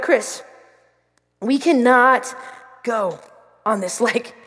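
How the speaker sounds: low, word-by-word insistence; firm warning. Retold or imitated. Sister or brother refusing the private lake, firm warning. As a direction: drop the voice and leave a pause after each chunk.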